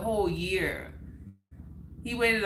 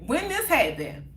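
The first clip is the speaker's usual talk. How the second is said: high-pitched imitated disbelief; shocked questioning; 5 semitones higher than usual. Boyfriend's mom asking about the breakup, shocked. His mother learns of the breakup.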